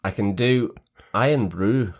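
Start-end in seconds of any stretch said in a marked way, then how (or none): 1.1-1.9 attempted Scottish accent, advert style. Shown as a demonstration. Co-host trying on request, Scottish accent. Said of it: "You could do a Scottish accent".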